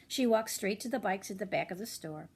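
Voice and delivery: plain reading voice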